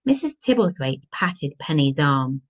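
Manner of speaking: plain reading voice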